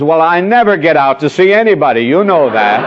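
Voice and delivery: hammed-up wife griping to her husband; complaining wife. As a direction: pile on the complaint quickly. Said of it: "Or perhaps she says".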